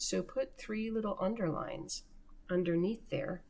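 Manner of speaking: ordinary delivery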